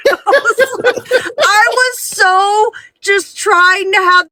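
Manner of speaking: laughing through the words, drawn out; can barely hold back the laughter. Real, not acted. Mother laughing while telling friends about her kids.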